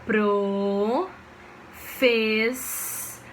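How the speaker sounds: "Pro, fez" as two drawn-out syllables; slow syllable demo of "Pro, fez"; split into syllables. Shown as a demonstration. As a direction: stretch "Pro", pause, then "fez". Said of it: "eu tenho que fazer assim".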